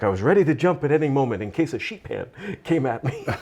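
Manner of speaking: put-on friend's Spanish accent, lightly done and laughing; quoting her in a Spanish lilt, laughing. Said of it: "She had that great Spanish accent".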